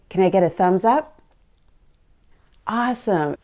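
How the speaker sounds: normal talking voice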